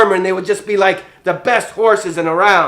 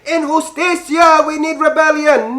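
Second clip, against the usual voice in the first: hammed-up call to rebellion; mock rebel shout; 6 semitones higher than usual.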